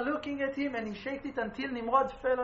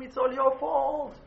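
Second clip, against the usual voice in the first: blaming; 3 semitones higher, 6 dB louder than usual. Retold or imitated. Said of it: "she's blaming him".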